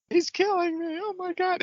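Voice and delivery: hammed-up high falsetto victim; mock high victim cry. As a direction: squeal it way up high like a goofy victim. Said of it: "this guy just like".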